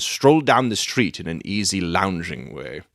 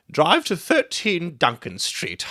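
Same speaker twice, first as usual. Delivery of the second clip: higher, slow mock call of an old woman; imitating the old woman; 10 semitones higher than usual. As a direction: echo the crone calling out to the cabby. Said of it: "she cried".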